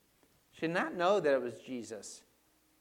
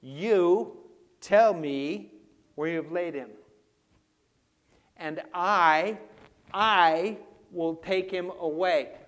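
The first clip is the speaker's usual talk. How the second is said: loud, raised, slow insistence; emphatic command; 4 semitones higher, 11 dB louder, slower than usual. Acted. Mary demanding Jesus' body from the gardener, loud and emphatic. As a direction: demand it forcefully, drawing out the key words. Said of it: "that's in the imperative".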